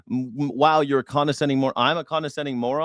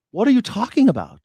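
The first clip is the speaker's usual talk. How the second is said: higher, louder outraged repeat; exasperated yell; 9 semitones higher, 5 dB louder than usual. Real, not acted. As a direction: hurl the question in disbelief.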